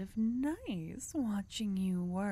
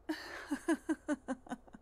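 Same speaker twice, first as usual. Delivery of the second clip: short knowing laugh; amused laugh; 8 semitones higher than usual. Acted. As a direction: laugh off the question, a little smug. Amused at the fire question.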